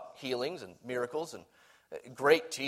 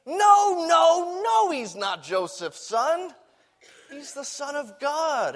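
high, loud, emphatic no no no; preacher shouting the answer back to the crowd; insistent shouting protest; 10 semitones higher, 5 dB louder, slower than usual. Retold or imitated. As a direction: cry out the correction with conviction. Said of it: "we might say".

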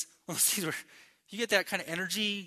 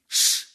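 loud, short "shh"; hushing shush; 9 dB louder than usual. Acted. Preacher showing Jesus stilling the sea, hushing shush. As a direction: imitate a commanding shush.

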